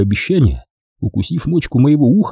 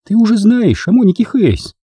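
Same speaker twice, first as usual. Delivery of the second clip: put-on higher young woman's voice, teasing; Дженни; 11 semitones higher than usual. A narrator's reading. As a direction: play the girlfriend, sweet and teasing. Jenny voiced. (нежная игривая Дженни)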